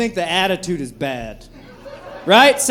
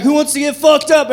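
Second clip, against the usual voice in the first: party yell; 7 semitones higher, 4 dB louder than usual. Retold or imitated. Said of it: "you're like".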